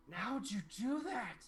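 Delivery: put-on gasp, then ask like an astonished child; amazed little girl. Game master as little girl seeing a trick, amazed. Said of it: "this little girl's face just goes".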